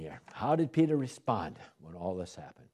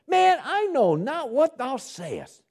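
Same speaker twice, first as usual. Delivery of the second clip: much higher, slow, strained denial; Peter denying; 7 dB louder, slower than usual. Acted. Preacher acting Peter's desperate, high-pitched denial.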